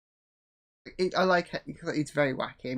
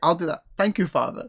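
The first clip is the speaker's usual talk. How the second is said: eager obedient son; 3 semitones higher, 5 dB louder than usual. Retold or imitated. Mimicking the son eager to please his father. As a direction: play the keen son thanking his dad.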